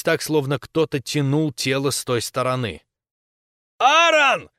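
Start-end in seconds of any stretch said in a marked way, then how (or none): 3.8-4.5 loud, very high desperate cry; shouted name. A narrator's reading. Captain calling the dragged-away corporal, shouting. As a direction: scream after him. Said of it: "крикнул я".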